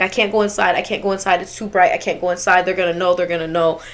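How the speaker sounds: low, hushed paranoid repetition; quiet, anxious self-talk. Retold or imitated. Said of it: "so paranoid".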